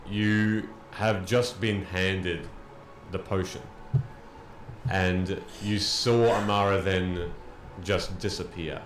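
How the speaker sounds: slow, loud, weighty storytelling; dramatic narration. Acted. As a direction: narrate the fall, slow and heavy. GM narrates the battle's end.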